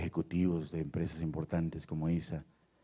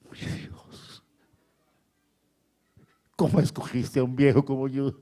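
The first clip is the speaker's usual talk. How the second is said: slow, broken whisper with sudden high cries; imitated tearful prayer; 6 semitones higher, much slower than usual. Preacher re-enacts the old man's broken, weeping prayer to Jesus. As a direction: act out overwhelmed praying, barely voiced then swelling. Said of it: "se suelta a llorar".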